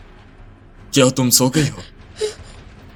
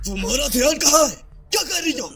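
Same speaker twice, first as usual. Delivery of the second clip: high-pitched, sharp reprimand; angry scolding; 14 semitones higher than usual. Acted. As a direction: scold a careless servant, voice raised in pitch. Boss scolds a careless servant.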